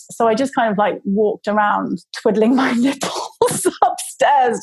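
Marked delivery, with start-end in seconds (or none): none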